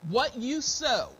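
ordinary talk

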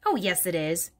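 ordinary delivery

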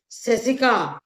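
calling her name out, sharp; a sudden angry shout of her name; loud call. Acted. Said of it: "గట్టిగా అరిచిన ఒక అరుపు".

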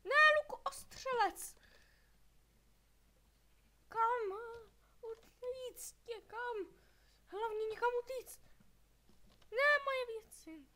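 high, loud frantic cries; real panicked yelling; panicked shouting. Real, not acted. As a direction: shout in panic while fleeing. Fleeing monsters in panic.

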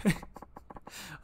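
breathy chuckle. Real, not acted. Quiet laugh at losing enemy.

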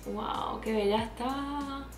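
ordinary delivery

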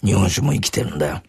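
a lightly voiced old man sharing wisdom; old man explaining room-temperature sake to the customers; low sage drinker. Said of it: "あの低く渋い".

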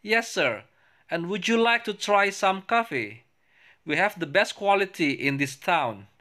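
high, slow, careful English reading voice; reading both parts for the class to copy; English dialogue read aloud. Shown as a demonstration. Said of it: "Saya ulang". (membaca dialog bahasa Inggris dengan nada tinggi dan pelan)

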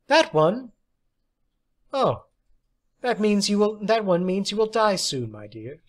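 a hint of mock cheer about death; fortune teller. A narrator's reading. Death card reading.

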